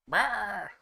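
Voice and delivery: high roaring monster growl; orc roar. Pretend. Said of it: "Get the orc screaming".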